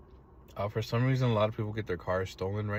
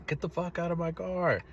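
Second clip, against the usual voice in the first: high-pitched mock yell, held back in volume; retold yell; 8 semitones higher than usual. Ordering the thief out of his car, restrained mock yell. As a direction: re-enact ordering an intruder out without full volume. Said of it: "I started yelling at him".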